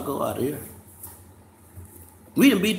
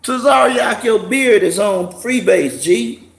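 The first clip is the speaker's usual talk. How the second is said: loud, high teasing shout across the room; roasting his friend with a loud, high shout; teasing call-out; 6 semitones higher, 8 dB louder, slower than usual. Real, not acted. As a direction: call him out loud and laughing. Calling out to Tazariak, teasing.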